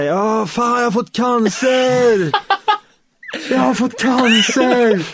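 high, drawn-out mock wail; retold panicked scream. Teller re-enacts a first smoke gone wrong, hammed-up screaming. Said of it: "Jag skrek så här".

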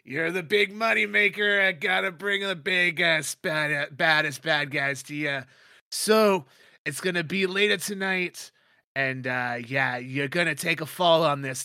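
Brixton boss voice. Acted. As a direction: sell the fix like a grinning boss.

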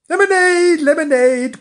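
high, loud call of lemonade; crying out. Acted. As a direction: call out like a seller. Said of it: "cried Mister Peto".

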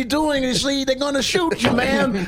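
very high, rambling comic fussing; hammed-up high-pitched impression; Cosby. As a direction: stumble through a warning, piling up fragments. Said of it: "I like the idea of Cosby being like".

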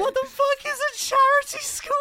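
high, loud incredulous shout; baffled outburst. Real, not acted. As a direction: blurt it out in disbelief.

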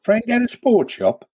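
high, slow disbelief; surprised question. Real, not acted. Player learning of his friend's sports shop, surprised. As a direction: question it slowly, pitched right up.

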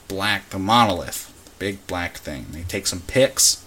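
normal talking voice

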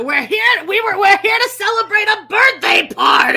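high, very loud re-enacted outburst; mock shouting. Re-enacting a reality-show birthday party fight, mock shouting. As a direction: holler it like a reality-show blowup.

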